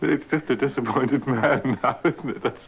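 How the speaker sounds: words squeezed out between giggles; trying to talk while still cracking up; laughing through it. Real, not acted. Newsreader explaining the joke, still laughing.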